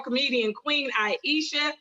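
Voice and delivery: ordinary talk, nothing marked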